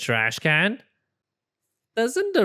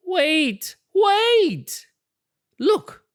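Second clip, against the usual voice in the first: much higher, urgent wait wait; excited cry; much slower than usual. Acted. Rabbit spotting the newspaper story, excited. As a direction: jump in with a bright idea.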